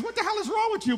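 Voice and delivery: a wife recoiling in disgust; appalled wife. Retold or imitated. Wife reacts to the bag in the car, high appalled outburst. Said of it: "she goes".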